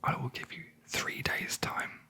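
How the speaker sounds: usual speaking voice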